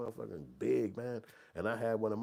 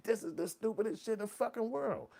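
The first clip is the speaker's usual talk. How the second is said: indignant rant; 13 semitones higher than usual. Retold or imitated. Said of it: "I'm like".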